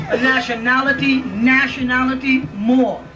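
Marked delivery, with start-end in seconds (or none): none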